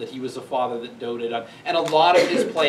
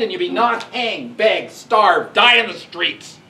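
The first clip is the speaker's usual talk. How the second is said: louder, higher furious threat; angry father; 5 semitones higher, 8 dB louder than usual. Retold or imitated. Capulet raging at Juliet for refusing the match, furious shout. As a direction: bellow it at your defiant daughter. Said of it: "he says".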